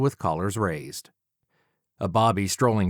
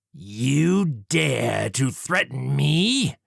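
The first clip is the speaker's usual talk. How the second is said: very slow, higher, affronted villain; indignant Jack; 5 semitones higher, much slower than usual. Acted. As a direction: drag out the outrage. Villain Jack voiced in story.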